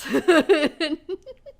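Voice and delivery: high bright burst of laughter. Real, not acted. Laughing at own story.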